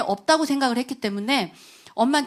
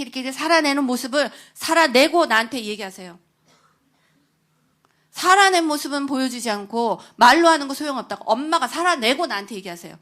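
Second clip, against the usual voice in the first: pushed louder, insisting on 살아내고; son's retort; 2 semitones higher, 7 dB louder than usual. Retold or imitated. Teenage son challenging his mother, loud and insistent. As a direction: throw the challenge back at her.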